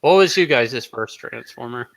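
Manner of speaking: ordinary talk, nothing marked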